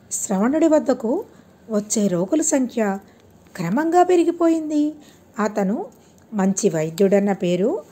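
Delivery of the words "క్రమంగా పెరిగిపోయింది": ordinary delivery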